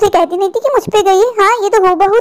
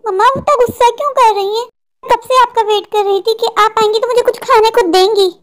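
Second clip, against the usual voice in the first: put-on higher childlike voice, asking mother; whiny little daughter; 4 semitones higher than usual. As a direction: play the hungry little girl pleading. (छोटी बच्ची की रूठी, ऊँची आवाज़)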